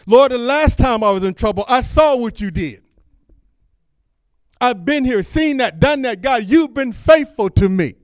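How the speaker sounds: high, loud preached testimony; preacher voicing Jehoshaphat recounting God's help, loud and high; impassioned prayer. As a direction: shout the praise up to God. Preaching prayer at full force.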